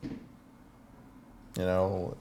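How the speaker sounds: ordinary talk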